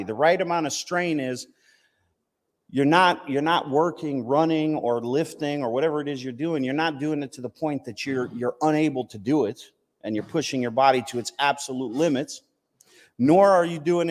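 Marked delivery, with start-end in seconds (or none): none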